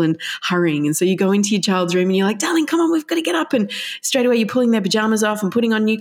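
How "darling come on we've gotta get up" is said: higher, hurried mum voice; chivvying a sleepy child, sing-song. Retold or imitated.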